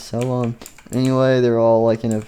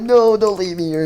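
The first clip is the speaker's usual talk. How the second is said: desperate plea; 8 semitones higher than usual. Acted. Character begging not to be left.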